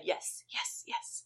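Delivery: breathless whispered yeses; little ghost girl whispering to Amanda. Retold or imitated. Ghost girl answering, nodding.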